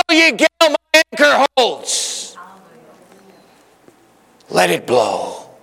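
high declaimed hymn line; hymn recitation. Acted. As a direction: proclaim it like a hymn of faith.

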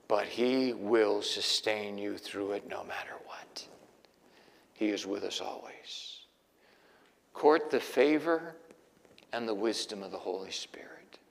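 hushed devotion. Real, not acted. Preacher comforting the congregation in a hush.

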